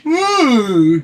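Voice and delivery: high, loud whoop; mock whoop. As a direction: whoop it out like you're impressed. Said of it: "He's like".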